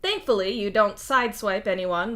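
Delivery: usual speaking voice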